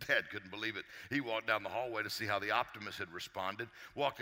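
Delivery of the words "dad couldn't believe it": ordinary talk, nothing marked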